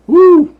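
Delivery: high loud woo; a high hooting woo; woo whoop. Real, not acted. Exclaiming after story.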